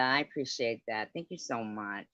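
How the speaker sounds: usual speaking voice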